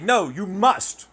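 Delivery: high, loud, slow demand; mock insistence. Critic insisting he must be polite, slow mock demand. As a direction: drag it out like a nagging scold.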